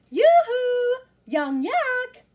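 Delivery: high, loud call over a fence. Acted. Wise old yak calling over the fence, loud high call. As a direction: hail her from next door. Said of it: "yelled over the fence".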